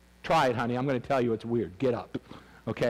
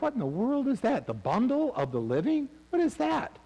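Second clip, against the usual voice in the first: high-pitched, incredulous questioning; mock bafflement; 8 semitones higher than usual. Puzzled listener hearing strange Bible imagery, mock bafflement. Voicing the congregation's puzzled reaction.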